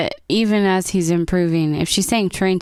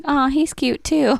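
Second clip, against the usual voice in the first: slow, high, swooning aww; gushing; 6 semitones higher, slower than usual. Retold or imitated. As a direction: coo over the cute guy. Gushing over handsome character.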